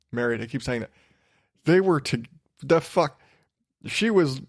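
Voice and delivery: ordinary talk, nothing marked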